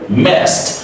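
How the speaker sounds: 'messed' drawn out, ending on a soft T. Shown as a demonstration. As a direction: say 'messed' as mest. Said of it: "the ending D is a soft T".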